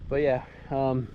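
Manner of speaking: out of breath after a hill run; panting, out of breath; gasping for air. Real, not acted. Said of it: "sorry I'm out of breath".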